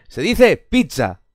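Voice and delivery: "pizza" said with a ts sound, "pit-sa"; slow, deliberate "pizza" with ts; Italian pizza. Shown as a demonstration. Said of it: "Pizza porque lleva dos zetas".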